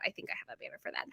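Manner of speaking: quick, whispered note to self; whispered aside. Real, not acted. Shop owner checking to herself for the banner, whispered aside. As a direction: whisper it fast under your breath.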